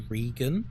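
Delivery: "Regan" said as REE-gan; mispronounced name. Retold or imitated. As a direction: say "Regan" with a long ee, REE-gun. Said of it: "People say my name like".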